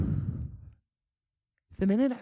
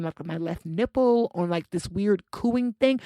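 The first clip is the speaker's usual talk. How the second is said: usual speaking voice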